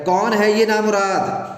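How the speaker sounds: exasperated lady of the house yelling; irritated shout. Acted. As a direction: snap at the door-knocker, call the servant. Annoyed wife shouts for servant.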